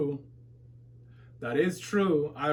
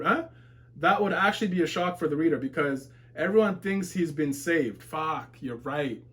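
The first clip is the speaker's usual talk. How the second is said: ordinary talk, nothing marked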